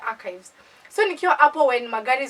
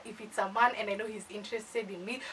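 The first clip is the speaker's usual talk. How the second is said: ordinary talk, nothing marked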